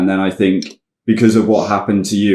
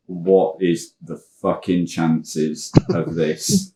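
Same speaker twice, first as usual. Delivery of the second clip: slow disbelief; 4 semitones lower, 7 dB quieter, much slower than usual. Retold or imitated. My heart just going.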